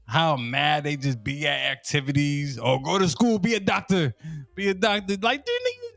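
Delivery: ordinary talk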